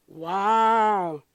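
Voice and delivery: plain reading voice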